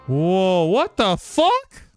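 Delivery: usual speaking voice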